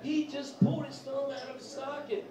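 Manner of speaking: high, frantic mock wife; hammed-up panic at the kids; freaking out. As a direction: squeal it like a panicked mom. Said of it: "she was freaking out".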